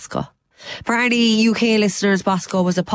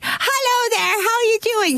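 Bosco puppet voice; 14 semitones higher than usual. Retold or imitated. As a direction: squeak it out like a kids' show puppet. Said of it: "And he was like".

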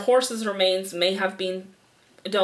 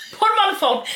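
high, louder gruff thief demand; mock gangster demand; 11 semitones higher, 5 dB louder, faster than usual. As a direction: bark it like a hammy kidnapper.

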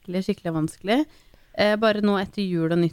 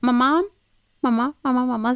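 put-on higher child calling mamma again and again; 5 semitones higher, faster than usual. Mother imitating daughter shouting mamma from bed. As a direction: call mamma like a kid who won't sleep. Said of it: "så roper hun".

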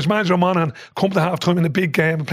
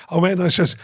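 usual speaking voice

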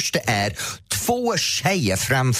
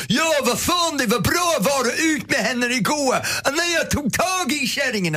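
mock shouting guy; 10 semitones higher than usual. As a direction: ham up the loudmouth on his phone. (hånfullt, ljust härmat telefonskrik)

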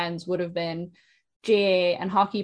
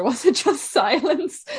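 laughing through the words; chuckling, slowed-down question; 7 semitones higher, 4 dB louder, slower than usual. Real, not acted. Laughing while asking about silence.

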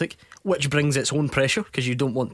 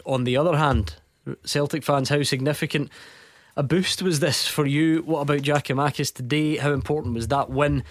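usual speaking voice

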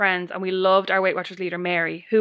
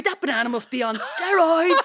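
high, laughing mock remark; front-row woman; 7 semitones higher than usual. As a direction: blurt it out, almost laughing. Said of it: "another woman in the front row goes".

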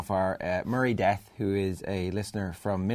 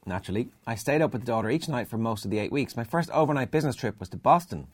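higher, projected storytelling read; email read-aloud; 3 semitones higher than usual. Acted. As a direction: read the letter out with punch. Reads out listener email.

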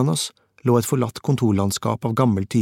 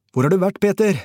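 high, urgent call down the phone; breathless shout; 9 semitones higher, 4 dB louder than usual. A narrator's reading. Fixer shouts to Peter over the stadium-like noise, breathless. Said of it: "ropte han andpusten".

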